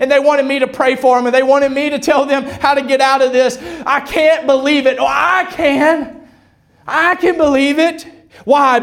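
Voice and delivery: high, driving preacher's cry; impassioned declaration to God and congregation; full-force preaching. Acted.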